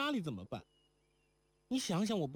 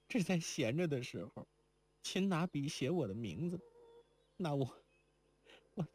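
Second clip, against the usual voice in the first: put-on higher girl's voice, mild weeping, breaking off; words fade as tears take over; tearful farewell; 7 semitones higher than usual. A narrator's reading. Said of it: "如莲又哭道". (哭着告别，话语中断)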